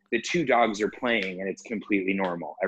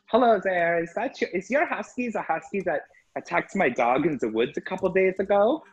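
a touch higher, prim neighbour voice; mock lady voice; 8 semitones higher than usual. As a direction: imitate the nosy dog owner lightly. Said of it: "she's like".

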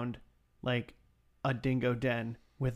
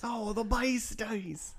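higher, slow Aussie voice with a laugh; laughing Australian accent; mock Australian; 8 semitones higher, slower than usual.

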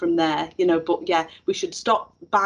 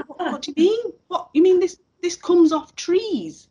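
astonished pupil; 11 semitones higher, 3 dB louder than usual. Retold or imitated. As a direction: gasp it out as if trees were news. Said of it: "I won't do the Scouse accent".

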